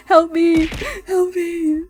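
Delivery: high mock cry for help; hammed-up call for help. Cries for help again.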